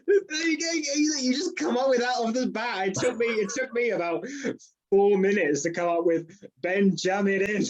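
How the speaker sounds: real laughter through the words, pitched high; high, helpless laughing protest. Real, not acted. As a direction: talk while cracking up. Cracking up at the joke.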